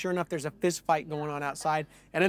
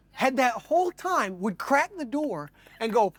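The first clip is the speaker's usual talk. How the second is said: plain reading voice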